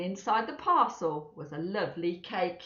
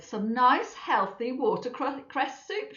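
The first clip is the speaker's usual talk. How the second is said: slow cheerful mother; 4 semitones higher, slower than usual. Acted.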